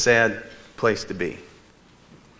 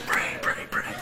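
whisper; 7 dB quieter, slower than usual. Retold or imitated. Urging family quietly during call.

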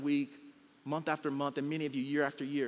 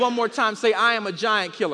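high, loud, driving sermon delivery; rousing pulpit storytelling with acted dialogue; full-force preaching; 5 semitones higher, 8 dB louder than usual. Real, not acted. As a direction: preach the battle story to a whole room. Leads congregation in a chant.

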